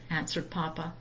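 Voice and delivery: usual speaking voice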